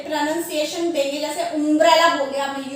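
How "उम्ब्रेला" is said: उम्ब्रेला starting with an "oom" sound; wrong pronunciation. Shown as a demonstration. Teacher showing pupils their mistake, उम्ब्रेला mispronounced with "oom". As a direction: open उम्ब्रेला on a short "oo". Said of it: "अब आप इसको गलत pronunciation".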